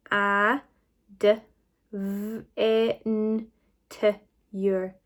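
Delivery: each sound of a, d, v, e, n, t, ure said alone. Shown as a demonstration. Teacher checking all the sounds, a, d, v, e, n, t, ure one at a time. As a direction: space out a, d, v, e, n, t, ure with pauses. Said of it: "let's just go through it together".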